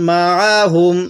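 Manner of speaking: মা আ হুম with the আইন pressed firmly; pressed ain. Shown as a demonstration. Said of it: "আইনটা একটু চাপ দিয়ে পড়ব". (আইন একটু চাপ দিয়ে)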